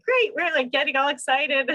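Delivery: high, chirpy imitation of an overly cheerful midwife; upbeat midwife. Mocking the midwife's cheer to the host, high and chirpy. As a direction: mimic the midwife's bright enthusiasm, lightly. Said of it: "my midwife kept being like".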